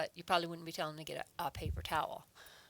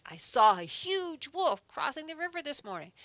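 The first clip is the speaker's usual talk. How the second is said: high and loud, full of feeling; excited exclamation; 8 semitones higher, 7 dB louder than usual. Shown as a demonstration. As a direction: put a lot of feeling into the wolf sighting. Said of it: "putting a lot of feeling into it".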